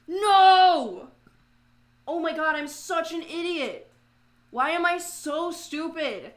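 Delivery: loud, high cry of self-disgust; angry at self. Real, not acted. Streamer cursing their own painting mistake, angry at self. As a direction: wail in real frustration at your mistake. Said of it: "I'm so mad right now".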